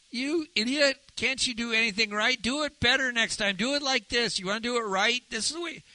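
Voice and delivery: high-pitched nagging critic; mock harsh criticism. Preacher mimicking someone criticizing to get obedience, high nagging scolding.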